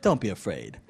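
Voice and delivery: low, soft aside; very quiet, casual calm; understated reassurance. Acted. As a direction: say it low and offhand, deadpan. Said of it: "and he goes".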